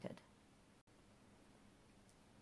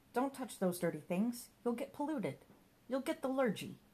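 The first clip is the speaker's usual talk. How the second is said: lightly voiced mischievous companion, rising higher; fussing imp; 7 semitones higher than usual. A narrator's reading. Mister Giggles nagging Johnny about dirty things, lightly voiced fussing. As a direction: fuss and scold like a meddling imp.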